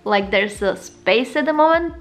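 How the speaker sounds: speaking around fingertip; finger resting on lip. Real, not acted. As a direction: lip held down slightly. Seen 2: fingertip pressed to lower lip, pointing at teeth, throughout speech.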